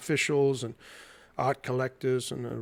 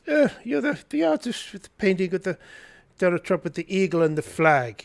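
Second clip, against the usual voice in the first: high-pitched mock snooty Australian woman; snooty, dismissive; 4 semitones higher than usual. Australian gallery director phones the artist, snooty and dismissive. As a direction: imitate a snooty director brushing off a painting. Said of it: "in a very snooty accent".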